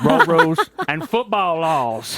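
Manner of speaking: movie-coach Texas drawl, slow and rousing; hammed-up Texas locker-room speech; Texas accent. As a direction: channel a Texan football coach giving the big speech. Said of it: "it's hard to do a Texas accent".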